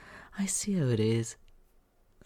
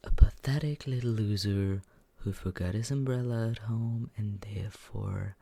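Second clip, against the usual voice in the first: usual speaking voice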